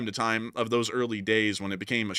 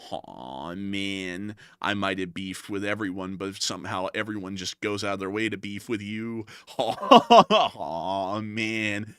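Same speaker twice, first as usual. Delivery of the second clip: mopey marmot; 2 semitones lower, slower than usual. Acted. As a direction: drag it out like a sad unemployed marmot. Marmot complains again.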